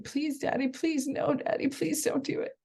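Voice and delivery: put-on pleading child. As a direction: mimic a little kid pleading with her dad. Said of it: "my child yell and scream and be like".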